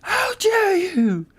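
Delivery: very high, squealing indignation; mock outrage. Insulted character demands a retraction, squealing mock outrage. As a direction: squeal it slowly in hammed-up fury.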